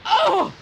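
high, loud scream of terror; man screaming at the moving books; terrified scream. A narrator's reading. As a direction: scream out in fright. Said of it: "I screamed aloud".

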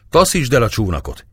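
mild storyteller's shout; shouted order. A narrator's reading. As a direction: call out the order without full shouting. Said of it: "kiáltotta Gergely".

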